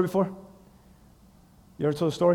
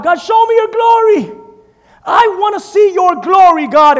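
higher, louder pleading shout; begging Moses; 5 semitones higher, 4 dB louder than usual. Acted. Moses begging God to see his glory, shouting.

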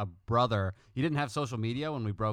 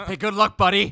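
loud high chuckling good luck; laughing mock warning; 9 semitones higher, 12 dB louder than usual. Warning the ex-friend's new pal, loud laughing mock.

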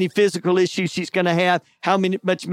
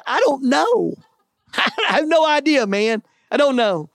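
dazed exclamation; 7 semitones higher than usual. Retold or imitated. Dazed answer to rescuer.

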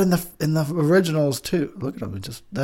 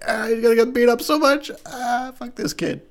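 high-pitched gleeful mockery; mock cruel parent; 10 semitones higher than usual. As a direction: play a parent cackling at their kid's fate. Voicing mean parents naming their kid.

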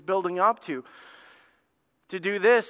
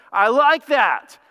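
loud, high, drawn-out relish; pleased approval; 7 semitones higher, 9 dB louder, much slower than usual. Acted. Balaam tempted by the king's money, loudly pleased. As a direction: savour the offer out loud.

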